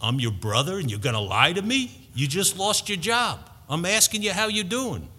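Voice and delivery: high-pitched, insistent New York brother; New York accent. Retold or imitated. Brother confronting him about lost job, high New York accent. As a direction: push it up high, imitating an exasperated New Yorker. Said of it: "in his New York accent".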